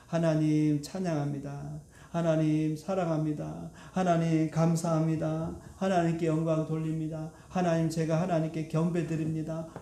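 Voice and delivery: unhurried, measured words of praise to God; devout confession. Acted. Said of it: "우리 입에 어떤 고백이 나오게". (낮고 느린 경건한 고백)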